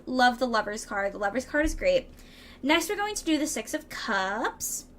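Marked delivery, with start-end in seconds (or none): 3.5-4.9 goofy upward swoop at the end; silly voice. Pretend. Reader announcing the next card, in a silly voice. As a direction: draw out the last word with a playful rise. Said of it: "why I said it like that".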